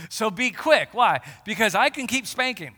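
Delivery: loud stern warning; emphatic preaching. Acted. Preacher warns the church of God's discipline, loud and stern. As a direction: drive the warning home.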